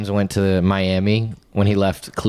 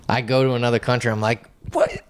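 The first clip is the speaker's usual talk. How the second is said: Patrice O'Neal impression; 4 semitones higher than usual. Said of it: "That Patrice O'Neal bit".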